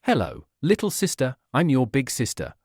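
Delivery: ordinary delivery, nothing marked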